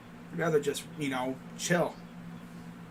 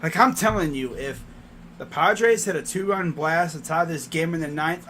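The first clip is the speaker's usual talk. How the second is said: normal talking voice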